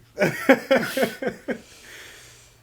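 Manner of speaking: high burst of laughter; loud laugh. Real, not acted. Laughing at the impression.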